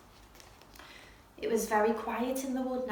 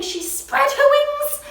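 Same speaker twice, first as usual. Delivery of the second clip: ordinary delivery, nothing marked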